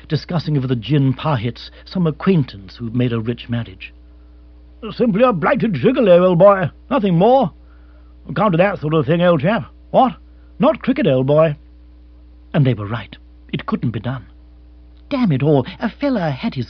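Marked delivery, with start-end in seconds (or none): 4.9-11.6 put-on higher, louder blustering old colonial; clubman bluster. Club men denouncing a fortune hunter, loud bluster. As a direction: scoff like a stuffy club member. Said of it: "they would say".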